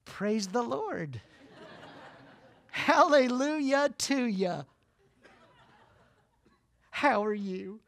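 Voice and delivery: soft, slow, syrupy church voice; butter them up, slow and soft; fake sweet greeting. Hypocrite greets family in parking lot, slow fake sweetness. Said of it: "Total fake hypocrite".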